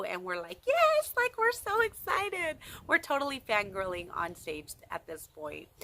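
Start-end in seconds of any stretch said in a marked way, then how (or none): 0.7-2.6 very high, loud squealed yes; eager yes. Retold or imitated. Fans agreeing to the singer's dare, squealed very high and loud. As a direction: squeal it like a thrilled fan.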